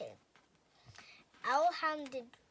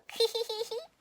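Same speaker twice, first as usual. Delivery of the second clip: laugh; 11 semitones higher, 7 dB louder than usual. Acted. Monster laughs in story.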